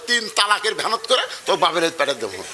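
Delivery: usual speaking voice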